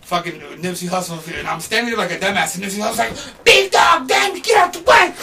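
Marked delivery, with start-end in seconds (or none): 3.4-5.1 very high, loud shout; retold urgent warning. Rapper warning him away from the spray, urgent shout. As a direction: yell to clear him out of danger.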